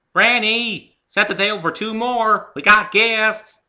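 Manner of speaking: high, loud call across the house. Acted. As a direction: shout to Granny inside. Applejack calling out.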